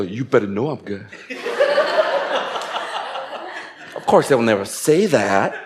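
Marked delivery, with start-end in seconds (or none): 0.0-1.1 secret smug thought. Retold or imitated. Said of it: "Deep inside, you go". 1.3-3.6 high, airy burst of laughter; a real laugh, high and breathless; high breathy laugh. Real, not acted. Laughs at own joke.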